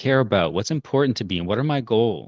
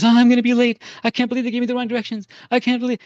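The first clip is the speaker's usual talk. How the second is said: imitated freak-out, very high-pitched and rushed; high, frantic mock panic; panicked old woman; 13 semitones higher, 4 dB louder than usual. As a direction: mimic an old lady panicking about being late. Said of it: "she had this panic attack".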